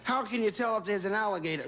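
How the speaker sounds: lady's voice. Acted. Woman asks the question again.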